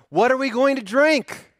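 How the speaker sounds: high, loud demanding complaint; grumbling complaint. Retold or imitated. Thirsty Israelites complain to Moses, loud and high. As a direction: whine it at Moses like a thirsty mob. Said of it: "they grumbled".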